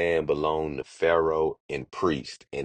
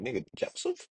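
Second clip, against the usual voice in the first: high, incredulous yelp at the name; can't believe what he's hearing; disbelief; 7 semitones higher, 10 dB quieter than usual. Retold or imitated. Father hears Joseph is alive.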